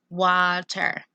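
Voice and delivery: "Water" said clearly in two beats; syllable beats. Shown as a demonstration. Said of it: "Let's check out water".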